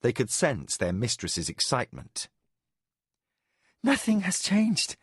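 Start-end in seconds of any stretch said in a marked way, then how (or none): 3.8-5.0 put-on high, breathless young woman; Mara, breathless. A narrator's reading. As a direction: gasp it out in delight. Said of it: "Mara said breathlessly".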